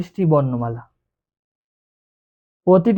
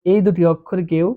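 ordinary talk

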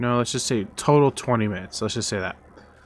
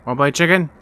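louder, higher playful challenge; loud taunt; 5 semitones higher, 7 dB louder, much faster than usual. Pretend. Taunting another driver louder.

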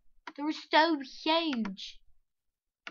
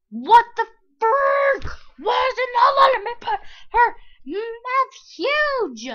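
much higher, loud exasperated yelling; frustrated shout; 11 semitones higher, 15 dB louder than usual. Real, not acted. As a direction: blow up at the game, yelling, slowly. Game won't make mouth huge.

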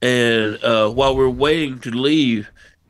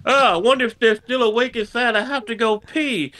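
high-pitched, badly acted innocent excuse; mock her phony cover story in a raised voice; terrible acting; 7 semitones higher than usual. Friend faking an errand to fetch the weed, badly acted. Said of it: "That's the worst acting I've ever seen".

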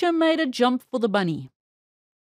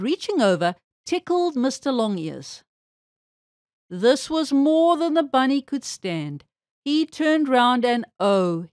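ordinary delivery, nothing marked

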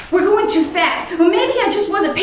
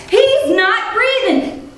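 a higher, urgent, clipped-off nurse's command; nurse snapping; 6 semitones higher than usual. Retold or imitated. Nurse snaps orders at the medic, urgent and higher. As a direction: bark the orders like a nurse in a crisis. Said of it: "she snaps at me".

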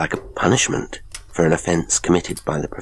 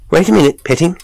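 raised, high call to a departing servant; 12 semitones higher, 8 dB louder, faster than usual. A narrator's reading. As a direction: call the butler back, loud and high. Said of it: "His master called".

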